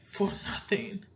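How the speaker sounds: usual speaking voice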